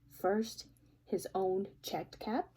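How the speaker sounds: plain reading voice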